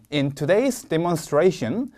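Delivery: slow, careful English read-aloud; slow model reading. Shown as a demonstration. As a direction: read it slowly like a model answer. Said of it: "제가 먼저 읽어보겠습니다". (천천히 또박또박 읽는 시범)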